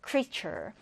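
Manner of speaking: "creature" with CREA stressed, falling after; first-syllable stress. Shown as a demonstration. Teacher introducing the next word, stress on first syllable of "creature". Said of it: "첫 음절의 강세를 두고".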